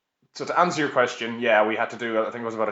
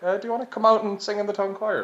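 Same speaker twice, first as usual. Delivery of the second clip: much higher, laughing mock invitation; mock choir recruiter; 10 semitones higher than usual. Town choir members inviting him to sing, in a high laughing mock voice. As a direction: ham up the eager choir member, giggling.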